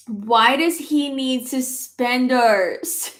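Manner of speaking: slow, drawn-out incredulous question; mock disbelief. Real, not acted. Questioning the baby suspenders, slow mock disbelief. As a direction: drag it out in disbelief.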